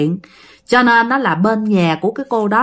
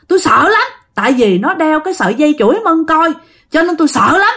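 ghost shrieking; 6 semitones higher than usual. Retold or imitated. Ghost Liễu shrieking at the villagers, high and wailing. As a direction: scream it out as the vengeful ghost. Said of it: "mới la làng lên nói rằng".

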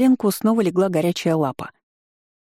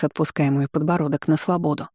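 ordinary delivery

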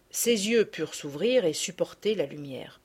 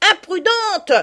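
put-on high, loud girlish reproach; girl scolds a reckless friend; scolding; 12 semitones higher, 7 dB louder, slower than usual. A narrator's reading. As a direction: scold her rash friend. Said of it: "dit-elle".

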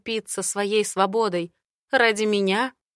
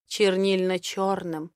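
ordinary delivery

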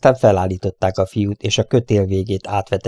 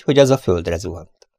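ordinary delivery

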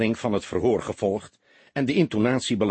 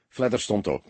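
ordinary delivery

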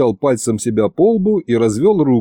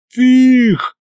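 high, loud outburst; explosive, high-pitched refusal; sudden yell; 12 semitones higher, 5 dB louder than usual. A narrator's reading. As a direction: yell it out in protest. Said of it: "заорал я так".

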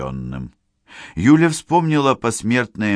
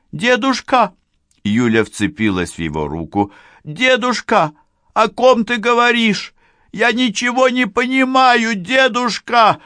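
high, louder desperate cries; frantic granddaughter; 5 dB louder than usual. Acted. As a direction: cry out in panic. Yulia frantic.

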